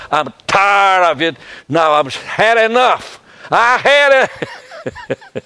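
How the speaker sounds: loud, high, drawn-out outburst; fed-up shouting. Retold or imitated. Man tells God he has had enough, shouting fed up. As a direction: build to an exasperated yell, stretching the words. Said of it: "I finally got fed up".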